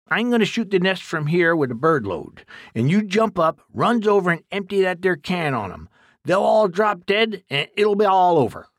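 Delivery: put-on folksy old man warning his helper; rustic old exterminator. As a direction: play a blunt country codger giving orders. Duke explains the plan.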